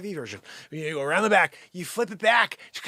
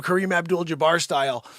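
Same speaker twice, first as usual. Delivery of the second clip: normal talking voice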